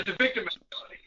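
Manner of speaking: a quick whispered remark; whispered aside. Real, not acted. Sneering aside to the host while he reads, whispered. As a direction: whisper it under your breath. Muttered aside during reading.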